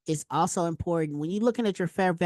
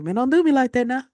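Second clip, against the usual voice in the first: mock complaint; 6 semitones higher, 7 dB louder than usual. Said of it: "I was like".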